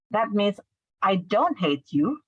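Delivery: ordinary delivery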